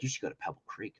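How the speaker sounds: soft, low secret tip; hushed tip. Retold or imitated. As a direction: let them in on a secret. Ranger's hushed tip retold.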